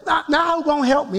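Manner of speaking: high, quick retold protest; upset plea to God. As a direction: fire off an upset question to God. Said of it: "and I'm really upset".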